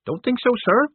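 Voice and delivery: high eager recruit voice; nervous young recruit. Acted.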